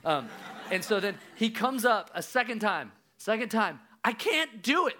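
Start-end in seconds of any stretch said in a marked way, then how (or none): 4.0-5.0 put-on high-pitched, panicky child's cry; more urgent. Dad imitates son surfacing a second time, more urgent. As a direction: imitate a drowning kid calling out urgently. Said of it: "There's, like, more urgency in his voice".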